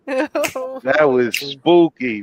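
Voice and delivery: slow, drawn-out spooky praise; spooky drawl. Real, not acted. Host praising the poet's spooky piece, drawn out slow. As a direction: stretch it out, savouring the chill. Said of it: "host drawls praise".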